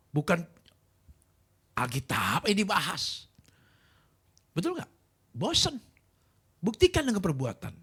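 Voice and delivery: loud, high, impassioned sermon delivery; fired-up preacher challenging the crowd; full-force preaching. Real, not acted. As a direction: drive the point home to the congregation.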